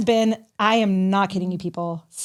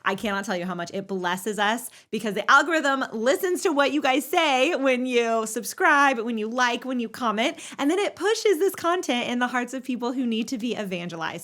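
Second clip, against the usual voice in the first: soft, close ad read to listeners; subscribe appeal; 6 dB quieter than usual. Acted. Host asks listeners to subscribe, soft ad read. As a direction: gently ask the audience for a favor.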